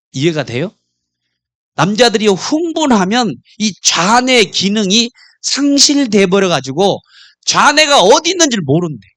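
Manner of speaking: normal talking voice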